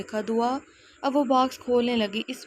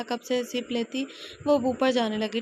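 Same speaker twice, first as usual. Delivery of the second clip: ordinary delivery, nothing marked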